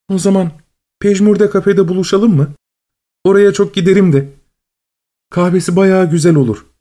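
high, lively and bright; chirpy high voice. A narrator's reading. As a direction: sound eager and sunny. Cheerful woman suggests cafe.

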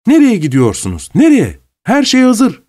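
put-on high, rising impatient girls' call. A narrator's reading. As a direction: hurry them back. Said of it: "sabırsız kızların".

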